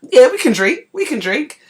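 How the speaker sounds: a student brushing off a teasing challenge, loud and defiant; defiant insistence. Retold or imitated. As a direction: insist loudly, refusing to look weak. Insisting she can drink.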